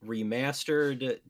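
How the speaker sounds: normal talking voice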